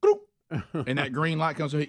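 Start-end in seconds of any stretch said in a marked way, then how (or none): 0.0-0.2 short "gloop" sound effect; quick mouth-made scanner beep; imitated machine blip. Scanner suddenly accepts the paper ticket, imitated blip. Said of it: "it goes".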